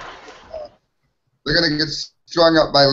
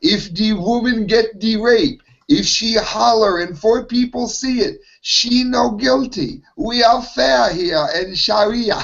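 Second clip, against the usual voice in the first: higher, slowing broken-English judge voice; mock judge accent; 6 semitones higher than usual. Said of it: "He th-, he says things like".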